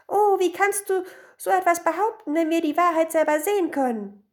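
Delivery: high, loud protest; high-pitched scandalised women; indignant outcry. A narrator's reading. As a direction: retort in hammed-up outrage. Women argue back, outraged.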